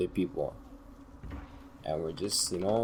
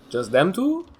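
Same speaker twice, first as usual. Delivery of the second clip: surprised; 10 semitones higher, 6 dB louder than usual. Retold or imitated. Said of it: "and I was like".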